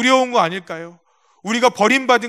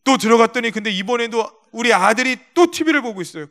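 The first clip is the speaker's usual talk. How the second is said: ordinary delivery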